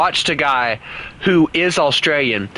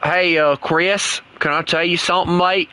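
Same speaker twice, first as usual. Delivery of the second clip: higher-pitched put-on Aussie accent; Australian accent; 4 semitones higher than usual. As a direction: coax a friend in mock Australian. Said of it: "keep on talking in this, uh, this accent".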